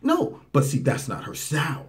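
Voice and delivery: usual speaking voice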